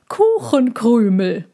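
lightly voiced fat dove, slow; a hint of a begging pigeon, slowly; the fatter dove begs for crumbs, slow, lightly voiced. A narrator's reading. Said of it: "sagte die dickere der beiden Tauben".

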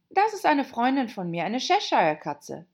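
Alice speaking brightly; young girl voice. A narrator's reading. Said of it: "sagte Alice".

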